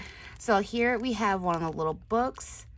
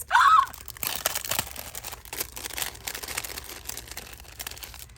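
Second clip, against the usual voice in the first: long, very high breathy gasp of delight. Real, not acted. Crafter seeing the next piece, long delighted gasp. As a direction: gasp like you can't believe it.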